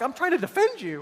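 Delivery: put-on a boy protesting to his grandfather; indignant young kid. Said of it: "I'm like".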